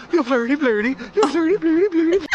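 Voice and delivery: high, sing-song mock Swedish chef; hammy Swedish chef voice; Swedish chef accent. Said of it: "Swedish chef".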